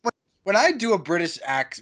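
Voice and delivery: usual speaking voice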